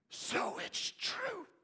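whispered, slow, high gasp of realization; hushed wonder; awed whisper. Acted. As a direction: breathe it in astonishment.

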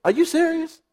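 high, disbelieving question; incredulous. Retold or imitated. Believer questioning God's ridiculous request, incredulous. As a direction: ask it like you can't believe your ears. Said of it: "You ever said".

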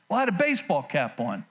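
ordinary talk, nothing marked

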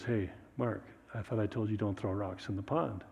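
soft, low, patient scolding; a father quietly calling out a child; gentle reproach. Acted. God gently scolds little Mark.

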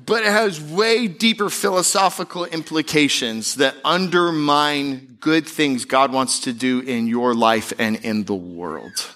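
ordinary talk

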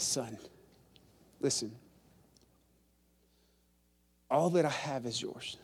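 calm the angry son softly; gentle father. Acted.

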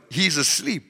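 ordinary delivery

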